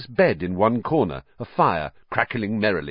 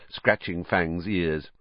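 ordinary delivery

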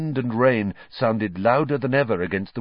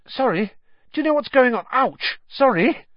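flustered; 5 dB louder than usual. A narrator's reading. Neville stumbles in dark.

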